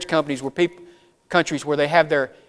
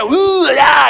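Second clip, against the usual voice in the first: wrestler promo shout; 9 semitones higher, 4 dB louder than usual. Retold or imitated. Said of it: "Randy Savage, Macho Man imitation".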